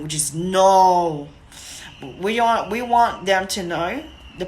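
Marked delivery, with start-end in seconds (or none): 0.3-1.3 slow, low, louder no; low-toned no. Shown as a demonstration. Trainer showing the bad tone, slow low louder no. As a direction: drag the no out low and heavy. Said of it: "compared to the low tone".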